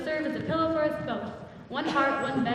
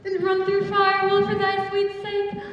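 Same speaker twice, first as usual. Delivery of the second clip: excited; 7 semitones higher, 8 dB louder than usual. Acted. Lysander wakes, declares love.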